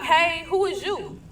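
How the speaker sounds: put-on suspicious question to a strange girl at the door; wary question. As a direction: draw the question out, sizing the stranger up. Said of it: "I said just like that".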